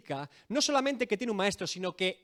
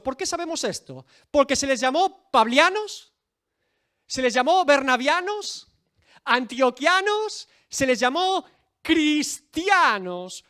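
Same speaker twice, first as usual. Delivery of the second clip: louder, higher preacher's rising questions; full-force preaching; 4 semitones higher, 6 dB louder than usual. Acted. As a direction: drive the point home from the pulpit. Preaching climax, rhetorical questions. (predicación enérgica con preguntas retóricas)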